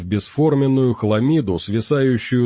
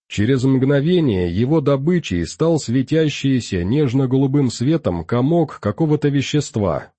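usual speaking voice

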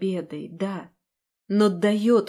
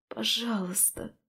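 hoarse whisper; 7 dB quieter than usual. A narrator's reading. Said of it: "шепчу хрипло, умоляю".

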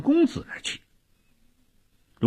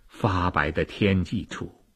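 usual speaking voice

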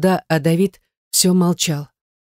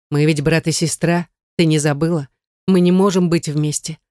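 usual speaking voice

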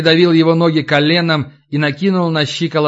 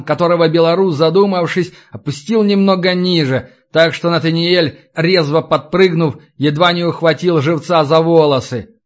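ordinary delivery

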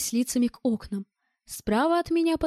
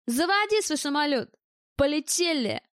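calling out; 4 semitones higher than usual. A narrator's reading. Bald gunman ordering departure through the doorway, shouting. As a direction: holler a brisk command to someone outside. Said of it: "крикнул Лысый".

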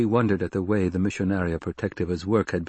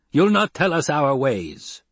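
sharp raised snap; snapped; 7 semitones higher, 4 dB louder than usual. Acted. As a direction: cut her off, higher and harder. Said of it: "Jameis snapped".

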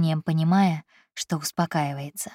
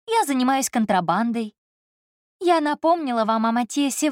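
higher woman's voice, lightly mocking; Elsa, teasing; 6 semitones higher than usual. A narrator's reading. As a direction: voice Elsa listing her sins playfully. Elsa's teasing list.

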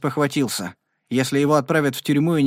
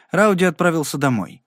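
ordinary delivery

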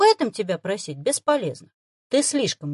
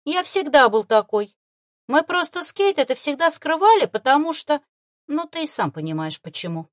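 higher, louder, haughty creature explaining; fox familiar voice; 7 semitones higher, 6 dB louder than usual. Acted.

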